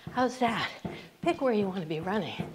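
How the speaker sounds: ordinary delivery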